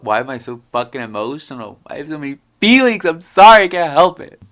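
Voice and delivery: high, loud, rushed mock wail; hammed-up emotional outburst. Sensitive vlogger excusing her feelings to viewers, hammed-up outburst. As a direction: wail it out in playful self-defence.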